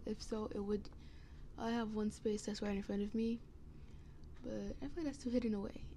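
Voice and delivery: usual speaking voice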